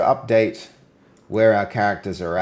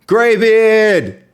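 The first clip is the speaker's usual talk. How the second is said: high, loud excited call of a name; excited shout; 12 semitones higher, 7 dB louder than usual. Real, not acted. Spotting a chatter, calling the name out loud.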